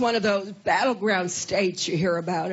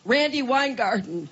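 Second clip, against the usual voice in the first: normal talking voice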